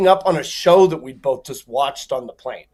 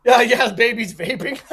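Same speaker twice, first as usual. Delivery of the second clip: laughing sarcasm; 6 semitones higher, 4 dB louder than usual. Retold or imitated. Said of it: "And I'm like".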